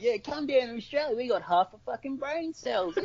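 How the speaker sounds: high, hammed-up dopey Australian voice; mock dim Australian. An Aussie parodying how his countrymen sound, high mock Australian. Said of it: "It's just like".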